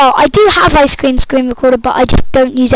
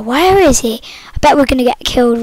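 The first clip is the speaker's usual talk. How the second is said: ordinary talk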